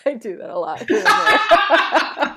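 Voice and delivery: put-on Southern accent, amused. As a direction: slip into a Southern twang, laughing. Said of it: "don't know where your Southern accent came from".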